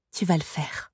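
soft, subdued insistence; murmured instruction. A narrator's reading. Sorceress to the girl who must obey, softly murmured. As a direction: let the voice drop, quietly settling it. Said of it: "murmura Soribel".

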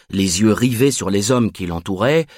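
plain reading voice

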